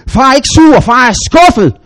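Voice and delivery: put-on loud, high shout at the toddler. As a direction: yell it like a frustrated dad. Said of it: "og råbte". (råbende far, høj og vred)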